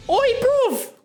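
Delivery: very high, loud Scottish "Oi"; Scottish shout. Acted. Streamer shouting proof to friend, Scottish. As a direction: yell it like a Scot.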